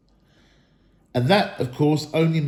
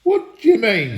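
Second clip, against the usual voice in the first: demanding troll; 9 semitones higher, 6 dB louder than usual. A narrator's reading. Bert demanding.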